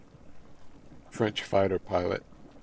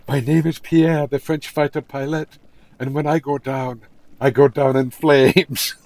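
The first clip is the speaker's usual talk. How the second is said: louder, higher, slowed grand declaration; proud Pierre punchline; 5 semitones higher, 10 dB louder than usual. Acted. Pierre telling Marie the punchline, loud grand boast. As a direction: deliver the punchline like a heroic pilot.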